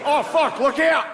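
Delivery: a cartoon Australian spots danger; high mock Australian alarm; Australian panic. Voicing an Australian in office.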